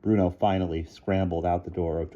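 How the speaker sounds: usual speaking voice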